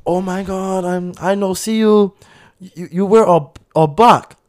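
put-on licht nagedaan Aziatisch accent, hoger en luider. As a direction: doe half spottend het Aziatische accent van de bestuurder na.

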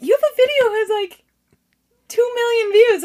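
very high, loud imitation of fan; starstruck fan. Parent raving about her video, high loud excitement. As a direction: gush it at high pitch, loudly.

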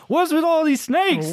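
high, hammed-up Walken voice; a deliberately bad Walken take; bad Christopher Walken impression. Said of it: "Let's do a bad one".

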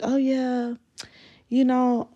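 lightly voiced girlfriend; imitating girlfriend. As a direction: act breezy on a fake-muted call. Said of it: "she'll mute the phone and be like".

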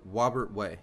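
"Wobert" with W replacing the R; slow childhood mispronunciation, "Wobert Way". Retold or imitated. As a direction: say Robert as "Wobert". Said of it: "I couldn't pronounce R's".

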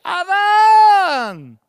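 high, loud grieving cry; sad calling. Acted. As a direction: cry out for a lost son. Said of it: "Pienso que la voz sonó triste". (grito triste y muy agudo llamando a Adán)

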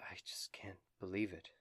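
soft whisper; whispered disbelief. Acted. Sister Grayleigh watching the prisoner leave, whispered. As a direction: breathe it in shock. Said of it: "Sister Grayleigh says".

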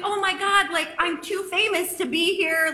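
mock diva. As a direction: play the self-important star. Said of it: "the narcissist in my brain".

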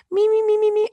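high sung me me me warm-up; vocal warm-up. Shown as a demonstration. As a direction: run a singer's warm-up scale up high. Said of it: "let me try it".

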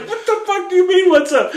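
high mock outraged squeal; mock outrage. Wife snapping at her husband, high mock outrage. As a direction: imitate her outrage, laughing. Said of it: "she's like".

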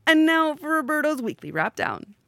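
loud, high show announcer. Acted. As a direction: introduce the segment like a host. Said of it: "what voice I'm giving myself this week".

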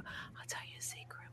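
breathy secret whisper, no voice; cupped hand whisper. Shown as a demonstration. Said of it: "I'll, I'll whisper".

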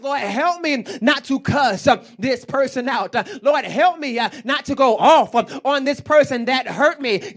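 fervent pleading prayer, loud; hammed-up desperate prayer; loud mock prayer. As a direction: beg the Lord, half laughing. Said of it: "the times I'm really before the Lord is".

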